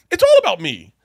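husband hams up admitting selfishness; mock confession, shouted. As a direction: bellow it like a guilty plea. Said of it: "I'm like".

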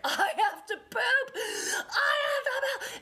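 high, slow, desperate half-laughing yell; screamed. Retold or imitated. Mom screaming at her daughter that she needs the bathroom. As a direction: scream it through the door in desperation. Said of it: "And I just screamed it".

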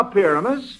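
ordinary delivery

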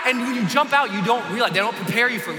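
ordinary delivery

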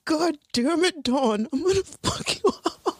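high-pitched exasperated threat; playful fed-up outburst; mock fury.

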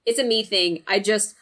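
ordinary talk, nothing marked

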